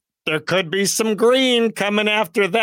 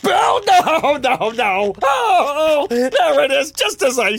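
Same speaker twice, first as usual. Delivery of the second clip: startled alarm; 8 semitones higher, slower than usual. Acted. Host startled by noise in wall.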